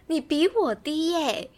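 a bit high, very slow and drawn-out gloat; gloating repeat. Real, not acted. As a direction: savour the words slowly to rub it in. Repeating the tease.

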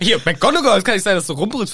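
hammed-up loud scolding; mock bellowing. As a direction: bellow a playful reprimand. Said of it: "Sie brüllt so laut".

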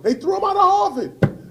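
normal talking voice

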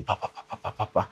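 soft, unvoiced rat-a-tat; imitated gunfire volley. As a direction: imitate a burst of shots in quiet puffs. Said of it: "מטח ירי על כל הרכבים". (חיקוי שקט של מטח יריות)

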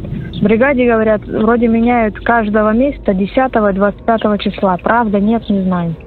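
ordinary talk, nothing marked

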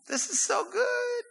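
very high, squealed relish; giddy delight. Real, not acted. Preacher savoring David's prayer, giddy high squeal. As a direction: squeal it with glee.

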